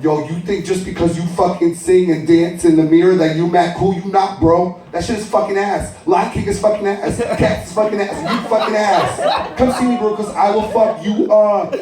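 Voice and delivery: high, aggressive street voice; older brother barks at his mother and sibling, high and aggressive; tough older brother. Acted. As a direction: pick a fight, all bravado. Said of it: "And here comes Duncan".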